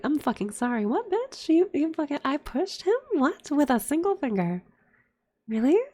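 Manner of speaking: high-pitched, incredulous comeback; indignant disbelief. Retold or imitated. Retelling a quarrel with dad's wife, incredulous. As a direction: throw the accusation back as absurd questions.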